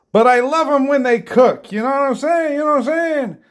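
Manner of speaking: high, loud delight; loud, lifted fond enthusiasm; gushing delight. Real, not acted. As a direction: rave about how good cooked onions are. Gushing about cooked onions.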